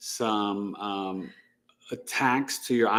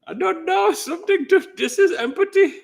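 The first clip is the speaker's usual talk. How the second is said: high, loud put-on voice laughing through it; squeaky put-on voice, much higher and louder; mock grumpy husband; 4 dB louder than usual. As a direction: whine for a drink like a helpless old man. Same put-on voice continues.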